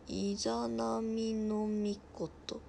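very slow, syllable-by-syllable reading; sounding out a name. Real, not acted. Streamer reading an unfamiliar god's name, slowly sounding it out. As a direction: stretch each syllable as you decipher it.

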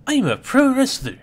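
high, loud proud boast; excited boast. Acted. A wrestler boasting before the bout, high and loud.

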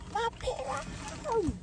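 thin, very high drawn-out squeal; high whine. Real, not acted. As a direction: squeak it out slowly, softly. Whining over a lost pillow.

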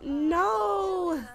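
high, drawn-out cry of protest; dismayed wail. Real, not acted. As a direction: wail it out in disbelief at losing. Her Sim's rival wins.

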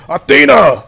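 very high, loud battle roar; Kratos yelling. Retold or imitated. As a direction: scream the names with full rage. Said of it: "you know, the yelling".

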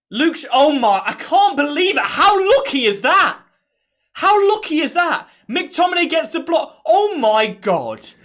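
high, loud incredulous rant; disbelief. Real, not acted. Fan replaying the lucky bounce, high disbelieving rant. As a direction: voice jumps up in disbelief.

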